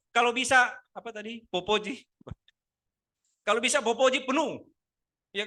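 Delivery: loud, high, driving sermon voice; full-force preaching. Acted. Pastor pressing the congregation, loud preaching. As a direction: preach it hard to the room.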